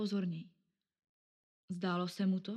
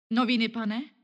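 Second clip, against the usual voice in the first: calling out; 3 semitones higher, 8 dB louder than usual. Acted. Young newspaper girl calls out.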